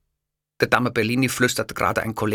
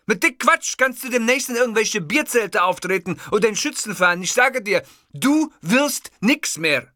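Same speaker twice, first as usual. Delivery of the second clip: berating tirade; 11 semitones higher than usual. Acted. Host berating the narrator as an amateur. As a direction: tear him down in scorn.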